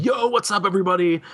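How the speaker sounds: high, hyped-up party guy; partier character. Retold or imitated. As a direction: call out like the life of the party. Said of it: "the partier type character".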